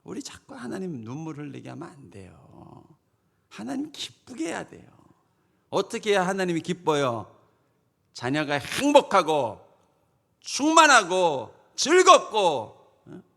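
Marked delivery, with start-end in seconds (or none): none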